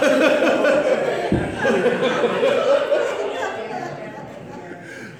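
long, loud high laugh; real helpless belly laugh; big laugh. Real, not acted. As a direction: burst out laughing at your own story. Laughs at his own story.

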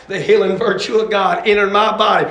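punchy testimony with explosive shouted words; forceful revival-preacher delivery; full-force preaching. Real, not acted. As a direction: preach it hard, bursting out on the key word.

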